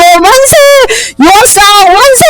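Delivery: high, drawn-out hurrah; acted cheer for the new king; cheering crowd. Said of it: "박수를 치고".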